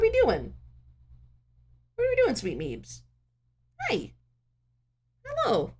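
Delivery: very high squeaky hello. Real, not acted. Streamer squeaks hello to her cat in baby talk.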